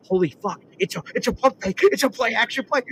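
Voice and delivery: high-pitched, breathless-fan play call; mounting excitement. Retold or imitated. Retelling rising excitement at the play.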